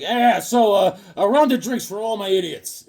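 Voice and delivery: hammed-up rowdy drinker; Conan. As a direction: order a round for the whole bar. Voices Conan at the bar.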